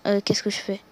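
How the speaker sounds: normal talking voice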